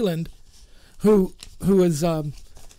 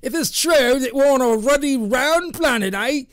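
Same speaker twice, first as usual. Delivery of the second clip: hammed-up Australian accent, higher and louder; 5 semitones higher, 3 dB louder than usual. Host mocking Americans in Australian accent, loud. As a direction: play the mock Aussie bloke, Australian twang. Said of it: "you really do need to start practicing your, your accent".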